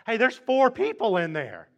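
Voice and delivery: freaking out. Acted. King spots a fourth person in the fire, astonished. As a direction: blurt it out in astonishment. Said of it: "everybody started freaking out".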